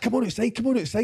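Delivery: quick, high mock goading; retold challenge. Acted challenge to a fight.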